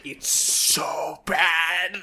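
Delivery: slow, fake sob over bad art; hammed-up crying; mock crying. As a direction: whimper it in mock despair. Despairing over the awful comic.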